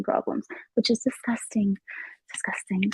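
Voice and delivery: whispered, voice dropped away; disgusted whisper. Real, not acted.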